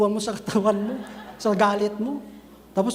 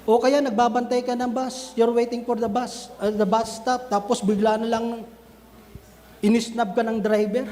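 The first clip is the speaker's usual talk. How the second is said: normal talking voice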